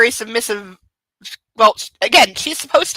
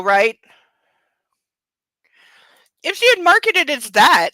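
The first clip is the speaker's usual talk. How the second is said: usual speaking voice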